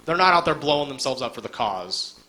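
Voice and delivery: ordinary talk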